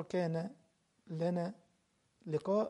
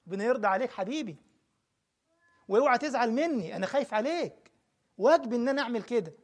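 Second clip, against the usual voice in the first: raised, insistent sermon voice to Hamada; forceful preaching; 4 semitones higher, 9 dB louder than usual. Acted. Preacher pleading loudly with Hamada, forceful preaching. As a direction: press the point home, pleading and firm. Preacher rebukes repeated question.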